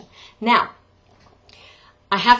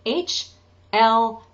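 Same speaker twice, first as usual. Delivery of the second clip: ordinary talk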